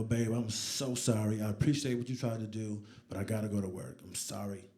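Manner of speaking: soft, low and contrite; hushed, sincere-sounding regret; tender apology. Retold or imitated. Apologising to wife as he leaves.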